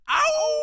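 loud high howl; wolf howl. Acted. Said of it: "which they call Wolf".

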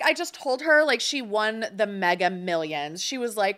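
ordinary talk, nothing marked

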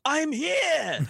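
very high falsetto answer; Roy popping up. Retold or imitated. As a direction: squeak it out high from behind. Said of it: "From right behind me, I hear".

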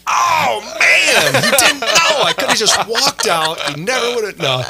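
high, loud, rushed burst of dismay; realizing too late that the priest brother had not known, panicked; panicked realization. Retold or imitated. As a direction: shout it like you just gave yourself away. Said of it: "I'm like".

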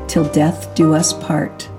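usual speaking voice

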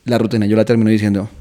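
usual speaking voice

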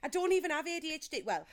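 ordinary delivery

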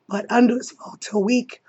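ordinary talk

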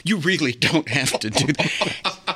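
embarrassed giggly refusal; flustered laughing demurral; laughing protest. Retold or imitated. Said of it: "I'm like".

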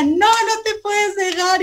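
high, drawn-out imitation of Dad's urging; exhorting father. Daughter retelling her father's advice at work, high insistent imitation. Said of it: "llegaba mi papá y me decía".

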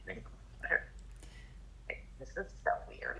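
breathy whispered aside, puzzled; whispered disbelief. Retold or imitated. Telling the listener, whispered disbelief at the plan. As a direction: whisper it like it's a strange secret. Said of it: "I was like".

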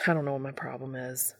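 low, soft, quick grumble; grumbling. A narrator's reading. Dean apologises to an irritated Castiel, grumbling. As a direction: grumble it fast under the voice. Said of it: "Dean grumbles".

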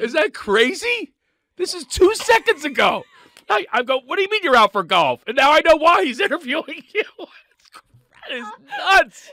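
high, loud, astonished rush; stunned host gushing in disbelief; flabbergasted. Real, not acted. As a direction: reel from the coincidence out loud. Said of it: "I've been flabbergasted before".

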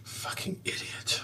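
whispered aside. Acted. Muttered insult aside.

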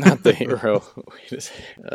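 really amused, laughing mid-phrase; laughing echo. Real, not acted. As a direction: repeat it through laughter. Echoing co-host's joke while laughing.

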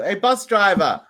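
calling out. Acted. Calling to the bus driver.

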